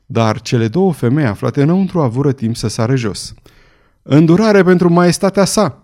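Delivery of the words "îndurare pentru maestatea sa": higher, louder plea for mercy; urgent shouted plea; pleading cry. A narrator's reading. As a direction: cry out to the mob. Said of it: "strigă cea mai tânără dintre femei".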